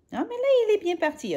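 quick, high burst of excitement; excited. Acted. Reading to a child, excited about the race start. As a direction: cheer the hare off, fast and high.

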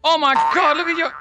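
loud, high cry of astonishment; shocked outburst. Real, not acted. Player seeing a giant head appear, shocked outburst. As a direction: blurt it out in shock.

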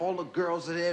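normal talking voice

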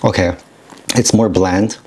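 chewing while talking; tasting food mid-sentence; mouth partly full. Real, not acted. Seen 1: bite taken at -5; lips pursed, still chewing while speaking.

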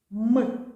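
a loud, held М sound; «М» pronounced as an isolated consonant. Shown as a demonstration. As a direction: sound out «М» loudly and clearly. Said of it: "І дивимось".

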